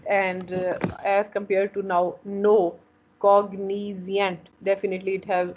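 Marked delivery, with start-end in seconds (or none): 3.2-4.5 "cognizant" slowly broken into three syllables; slow, segmented "cognizant" to show its syllables. Shown as a demonstration. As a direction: draw out each syllable of "cognizant". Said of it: "it is three syllable".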